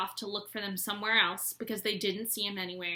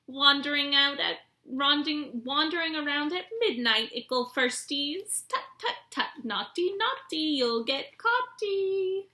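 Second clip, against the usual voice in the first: high, mocking sing-song poltergeist taunt; teasing poltergeist; 6 semitones higher, 5 dB louder than usual. A narrator's reading. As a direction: tease them like a gleeful spirit. Peeves taunting the first years.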